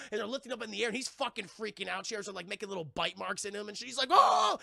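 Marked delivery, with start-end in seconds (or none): 4.1-4.6 high, loud mock-frightened wail; imitating a panicked victim; scared cry. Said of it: "He's scared".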